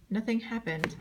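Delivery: plain reading voice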